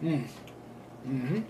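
chewing candy while humming; muffled closed-mouth hum; mouth full, chewing. Real, not acted. Seen 1: Puts bean in mouth at -3; closed-mouth chewing during hums.

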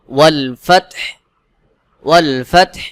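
"ফাতহ" ends on a vowelless breathy h; stopping pronunciation. Shown as a demonstration. Teacher shows the pause rule, "ফাতহ" ending in sukun. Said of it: "তখন উচ্চারণটা এমনভাবে হবে".

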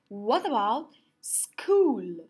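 ordinary talk, nothing marked

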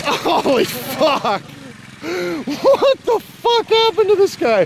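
slow, high-pitched, laughing amazement; laughing disbelief. Real, not acted. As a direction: draw it out while cracking up.

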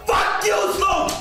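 triumphant shout; shrieking jackpot winner. Real, not acted. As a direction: yell it out in triumph. Big slot win celebration.

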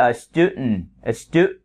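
"student" with the middle stopped into a nasal n; American student. Shown as a demonstration. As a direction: choke off "stu-" on a held breath. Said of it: "Đọc theo tiếng Mỹ".

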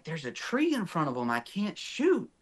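retold irritated protest, climbing high at the end; mildly irritated. Hunter to partner talking behind him, mildly irritated. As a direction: push back at a pestering partner. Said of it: "I'm kinda irritated that he's talking".

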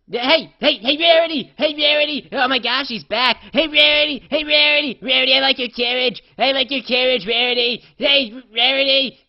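high, loud, eager yelling of her name; excited shouting. Retold or imitated. Pony shouting at Rarity as she passes again, loud and high. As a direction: wave her down at full voice. Calling again to returning friend.

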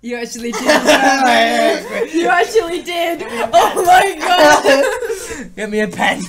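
high squealing laughter through words; giggling in delight; laughing. Real, not acted. Reading a card, laughing.

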